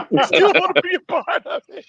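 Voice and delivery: high, laughing through the words. Real, not acted. Co-host joking about the show while laughing hard. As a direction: talk while still cracking up.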